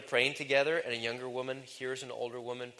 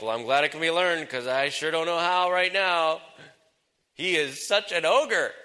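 louder, higher mock complaint; exasperated wife; 4 semitones higher, 7 dB louder than usual. Women glad it can be learned, exasperated. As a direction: imitate a wife venting about her husband, loudly. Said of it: "Some women are like".

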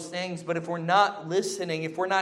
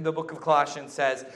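ordinary delivery

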